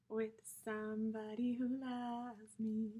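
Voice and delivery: soft unvoiced whisper. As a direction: breathe the line out like a secret. Whispered end of intro.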